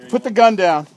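shouted order. Real, not acted. Urgent order on the boat.

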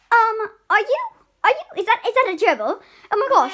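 loud startled questions; surprised. Acted. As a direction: react loudly to the gerbil. Principal spots gerbil.

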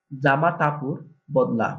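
"জামা কাপড় বদলা" spoken slowly and clearly in Barisal dialect; slow teaching repeat. Shown as a demonstration. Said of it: "বরিশালের ভাষা বলবো". (ধীরে, বরিশালের ভাষায়)

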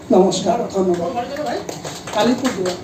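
ordinary talk

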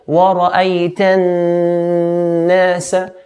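slow Wara'ayta nasa with a long nasal n between; held noon. Shown as a demonstration. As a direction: hum the n before nasa, then let go. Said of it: "So hold the noon, then let go".

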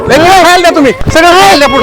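high urgent insistence; urgent pleading. Acted. Villager telling others to feed the ghost, urgent high insistence.

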